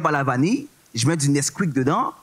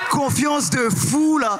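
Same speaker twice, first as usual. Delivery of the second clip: mock swagger shout; 9 semitones higher, much slower than usual. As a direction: stretch it out like a cocky cop. Mocking bike cops' attitude. (crier lentement, fanfaron)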